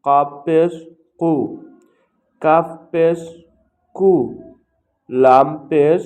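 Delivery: loud, slow, measured syllables with pauses between; letter-by-letter recitation drill. Shown as a demonstration. Teacher showing learners how to pronounce pesh, in a loud recitation drill. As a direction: call out each letter and its vowel slowly and loudly.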